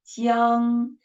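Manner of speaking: a single strong beat on "Jiang"; one syllable. Shown as a demonstration. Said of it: "That is one syllable".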